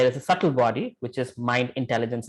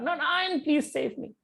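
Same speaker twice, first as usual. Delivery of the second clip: fading plea; 14 semitones higher, 6 dB quieter than usual. Acted. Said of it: "He screamed out".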